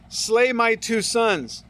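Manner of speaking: slow and weighty, each word laid down; slow solemn reading. Acted. Preacher reading Reuben's vow to his father, slow and solemn. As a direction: read Reuben's vow gravely, unhurried. Think that's gonna get back to his kids?.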